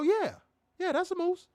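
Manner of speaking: squeaky crowd member agreeing; high mock agreement. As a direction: chirp along eagerly, way up high. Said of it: "And they go".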